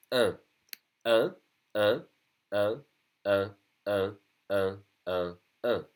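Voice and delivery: "un" repeated as an isolated nasal French vowel. Shown as a demonstration. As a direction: drill "un" through the nose, one at a time. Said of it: "Very nasal".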